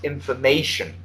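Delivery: "Information" spoken carefully, every syllable distinct; slow and clear. Shown as a demonstration. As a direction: model "Information" slowly for the student. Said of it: "Slow it down".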